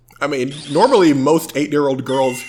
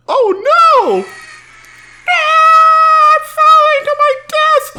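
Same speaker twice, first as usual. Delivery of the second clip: panicked scream; 7 dB louder, much slower than usual. Acted. Heroine falls into a pit.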